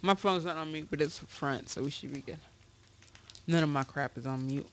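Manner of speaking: talking while chewing gum; words around a wad of gum. Real, not acted. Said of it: "I need to stop chewing gum".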